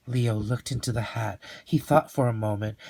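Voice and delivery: ordinary delivery, nothing marked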